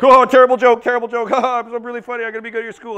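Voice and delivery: high-pitched frantic babble, loud then fading; blubbering mess. Retold or imitated. Nervous candidate telling jokes in a phone job interview, blubbering. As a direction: imitate yourself babbling helplessly through an interview. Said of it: "just like a blubbering mess".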